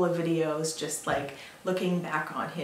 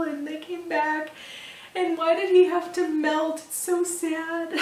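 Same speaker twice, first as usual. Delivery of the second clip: mock bawling; 10 semitones higher, 3 dB louder than usual. Pregnant mom recalls bawling over the melted snowman, mock bawling. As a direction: imitate a tearful wail, pitched well up and louder at first. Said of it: "I was, like, bawling".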